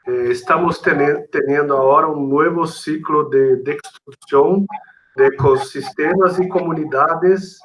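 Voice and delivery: normal talking voice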